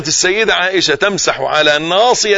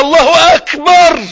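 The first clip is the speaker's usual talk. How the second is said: much higher, louder, slow الله أكبر; fervent cry; 13 semitones higher, 7 dB louder, much slower than usual. Shown as a demonstration. Preacher's climax, crying out the takbir. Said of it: "تطلع أكبر بقى".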